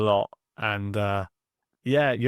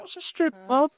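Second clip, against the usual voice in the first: put-on high, childlike question; curious child. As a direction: pitch way up like a kid asking. Kid asking parent.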